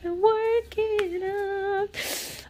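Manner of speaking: very high, stretched-out words; high, slow comic plea; drawn-out pleading. Pretend. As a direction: draw it way out in a high wail. Pleading with the unboxing.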